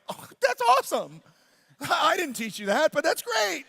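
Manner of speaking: high, loud happy amazement; delighted praise. Retold or imitated. Dad hears daughter likes to share, delighted amazement. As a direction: exclaim it like a thrilled parent.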